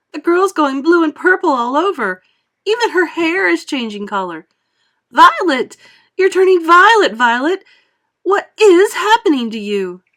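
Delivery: high, loud panicked cry; yelled. A narrator's reading. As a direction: cry out to heaven in terror. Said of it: "yelled Missus Beauregard".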